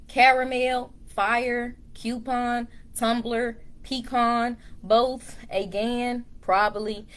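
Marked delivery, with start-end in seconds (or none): none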